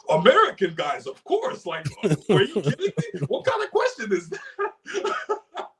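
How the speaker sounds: very high, laughing disbelief; imitating the girl, laughing. Imitating the Swedish girl scoffing at his question, high and laughing. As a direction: squeal it like the answer is obvious, slowing at the end. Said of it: "She was like".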